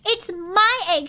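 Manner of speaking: mother bird sputtering; sputtered. A narrator's reading. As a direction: spit it out in a huff. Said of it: "she sputtered".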